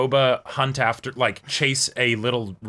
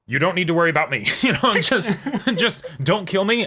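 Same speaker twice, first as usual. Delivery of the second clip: higher, quick, laughing plea of a little droid; pleading droid; 6 semitones higher than usual. Retold or imitated. Podcast host voicing a droid begging for its life, laughing. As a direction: beg them not to kill you, giggling.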